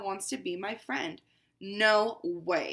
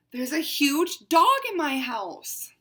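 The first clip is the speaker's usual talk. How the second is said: slow, urgent whisper; alarmed whisper; 5 semitones higher, 13 dB louder, slower than usual. Acted. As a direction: hiss it out in dismay. Cat alarmed.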